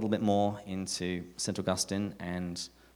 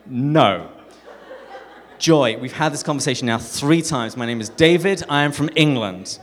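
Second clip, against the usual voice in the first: loud, raised-pitch comic outburst; exasperated shouting; 4 semitones higher, 11 dB louder than usual. Retold or imitated. Exasperated man correcting forgetful friend a third time, acted as comic shouting. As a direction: hammer out each fact, at the end of your patience. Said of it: "why he was shouting at me".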